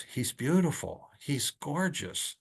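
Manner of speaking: a dreamy sigh of admiration; lightly imitated nurses swooning over him. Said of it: "all I heard was".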